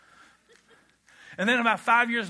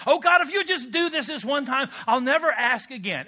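louder, higher mock plea to God; bargaining prayer; 5 semitones higher, 5 dB louder than usual. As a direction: plead your case like a desperate bargainer. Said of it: "we bargain with God".

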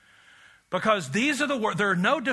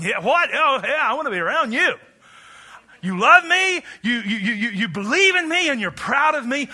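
higher, louder, eagerly acted excitement; excited reaction; 4 semitones higher, 6 dB louder than usual. Preacher acting out people who hear they are loved, excitedly. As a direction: play someone thrilled and astonished to hear it. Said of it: "you got people going".

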